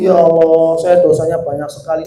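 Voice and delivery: low, soft imitation of tearful repentance; mock tearful lament. Teacher voicing Umar weeping over his sins, subdued mock lament. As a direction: lightly act out a remorseful plea to God. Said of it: "Nangis lagi".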